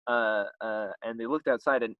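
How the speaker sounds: normal talking voice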